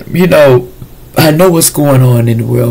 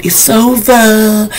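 slow drawn out; 8 semitones higher, much slower than usual. Real, not acted. Ending pronouncement.

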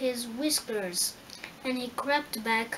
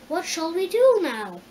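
put-on higher, louder girl's question; sadly asking; 6 semitones higher, 7 dB louder than usual. A narrator's reading. Dorothy asks her friends what to do, mildly sad. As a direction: ask with a touch of sadness, pitched up. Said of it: "asked Dorothy sadly".

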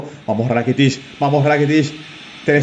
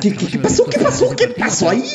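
shocked. Real, not acted. Sudden shock at a game play.

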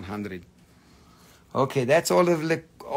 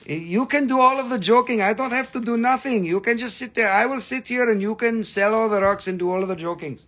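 teasing; 8 semitones higher, 4 dB louder, faster than usual. Retold or imitated. Teasing mock command to viewer.

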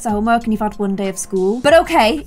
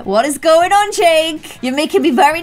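exasperated demand; 5 semitones higher, 4 dB louder than usual. Pretend. Scolds her game fiancé.

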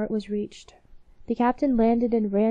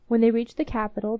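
ordinary delivery, nothing marked

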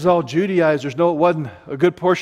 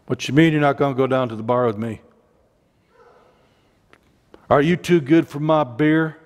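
mock gruff father; 4 semitones lower, faster than usual. Father mocking religious son.

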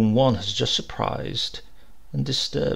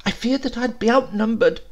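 alarmed; 10 semitones higher than usual. A narrator's reading. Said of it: "The postman, alarmed".

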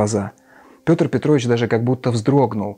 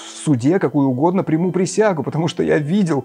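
put-on high, breathless insistent young man; excited young witness; 6 semitones higher than usual. A narrator's reading. Lebezyatnikov testifies to everyone, high and excited. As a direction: pour out the testimony, worked up.

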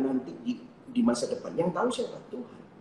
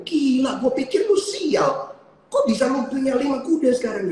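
high, loud astonished exclamation; amazed neighbour; 10 semitones higher, 4 dB louder than usual. Retold or imitated. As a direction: neighbour gasping at the sudden luck. Neighbour amazed at five horses. (tetangga berseru kaget dengan suara tinggi dan keras)

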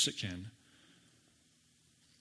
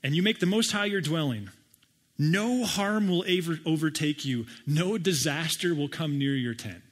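a preacher's grand scripture read; scripture reading, building; 4 semitones higher, 4 dB louder than usual. Acted. Reading psalm promises emphatically.

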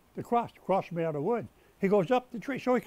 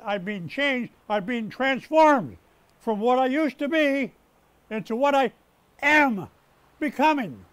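rising to a loud, slow pulpit climax; preaching; 2 semitones higher, 6 dB louder than usual. Acted. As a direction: build and hammer each word like a preacher. Preaches at full force.

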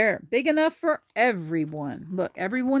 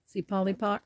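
usual speaking voice

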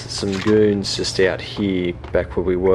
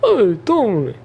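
high, drawn-out mock surprise; teasing high-pitched greeting; playful taunting call; 11 semitones higher, much slower than usual. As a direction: call out high to spring an ambush. Taunting enemies before ambushing them.

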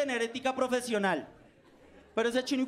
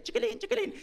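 put-on child's voice; 10 semitones higher than usual. Niña peleando en el salón.

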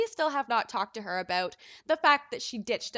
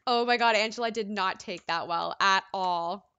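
ordinary talk

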